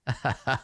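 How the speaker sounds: acted laugh in Granny's role; mocking old-woman laugh. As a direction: laugh at a would-be intimidator. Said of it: "she just starts laughing".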